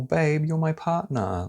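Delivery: high, slow mock-tender partner voice; sugary fake concern; fake caring partner. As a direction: imitate a manipulative sweet partner.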